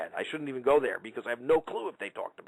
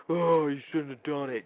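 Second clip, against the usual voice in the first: mocking scold; 2 semitones higher than usual. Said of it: "cocksuckers that go".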